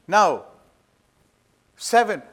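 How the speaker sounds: usual speaking voice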